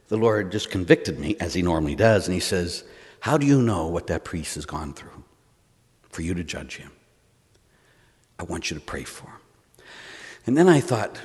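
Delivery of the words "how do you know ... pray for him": low, soft, searching voice; gentle rebuke. Retold or imitated. The Lord to an angry priest at prayer, soft and grave. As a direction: imitate a quiet correction with long pauses.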